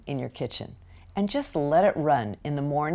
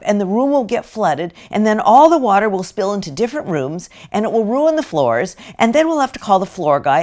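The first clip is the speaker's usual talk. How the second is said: put-on loud, higher run-on lecture to a child; over-explaining parent; 5 semitones higher, 8 dB louder than usual. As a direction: pile up consequences without stopping. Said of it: "And we say things like, I'll read this script".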